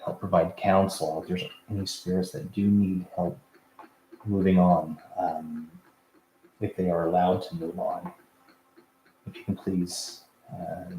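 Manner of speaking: quiet, low prayer voice. Real, not acted. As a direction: softly ask the archangels for protection. Prayer of protection before session.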